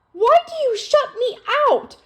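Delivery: high, loud, pleading outburst; anguished shout of a hurt younger sister; upset cry. Acted. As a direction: beg her sister to stop shutting her out. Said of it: "Feeling upset".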